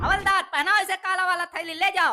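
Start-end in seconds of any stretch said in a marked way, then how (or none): none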